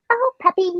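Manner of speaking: very high, sweet pet-talk voice; high-pitched cutesy voice. Retold or imitated. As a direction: coo to a puppy in a squeaky-sweet pitch. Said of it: "an even more pitched up version of her voice".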